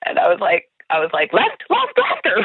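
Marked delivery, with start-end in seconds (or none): 1.3-2.4 high-pitched urgent backseat panic; panicked directions. Retold or imitated. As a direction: retell a passenger frantically calling directions. Panicked passenger directing the driver.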